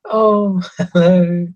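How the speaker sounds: high, loud, delighted hello; young girl running up to say hi; excited girl greeting. Acted. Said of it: "runs up to you going".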